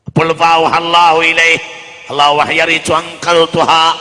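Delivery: loud, slow preaching; ringing sermon voice; full-force preaching. Acted. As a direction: preach it to the hall.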